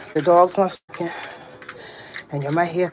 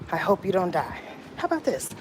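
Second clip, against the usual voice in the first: ordinary talk, nothing marked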